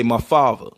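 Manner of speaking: "father" said as "favva"; Baltimore pronunciation. Shown as a demonstration. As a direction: make the th in "father" a v. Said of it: "or we say".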